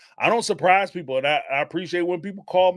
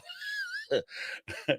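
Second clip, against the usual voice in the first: real high squealing laugh; high shrieking laugh; 11 dB quieter than usual. Real, not acted. Laughing at his own joke about the unwanted visitor. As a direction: let a high laugh burst out.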